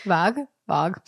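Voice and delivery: vague said as "vahg", open ah; new accent. Shown as a demonstration. Co-host testing the word in a new accent, vague as "vahg". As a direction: try vague with an ah vowel, questioning. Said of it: "I added a new accent to it".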